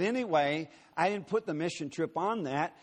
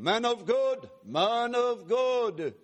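high, louder, slow drawn-out "Man of God"; calling voice; 6 semitones higher, 3 dB louder, much slower than usual. Retold or imitated. Stranger calling the preacher across Miami Airport, high and drawn out. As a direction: call him out, stretching each word. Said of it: "And this voice gets closer".

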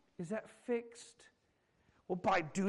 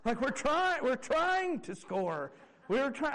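put-on loud, higher-pitched fed-up kid; indignant, exasperated comeback; exasperated kid retort; 6 semitones higher, 4 dB louder, slower than usual. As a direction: snap back at the nagging parents. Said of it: "would just turn around and be".

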